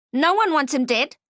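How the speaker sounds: high, loud goblin denial. Acted. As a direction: deny it in a brash goblin voice. Goblin denies quickly.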